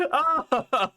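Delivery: ordinary talk, nothing marked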